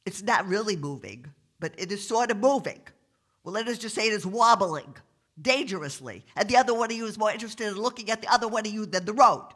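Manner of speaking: stuffed-up, officious cop voice; New York cop with cold. Acted. Cop lists the offences, stuffed-up New York officialese. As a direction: lecture them through a blocked nose. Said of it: "sounds like he's got a terrible cold".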